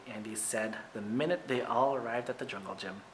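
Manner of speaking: usual speaking voice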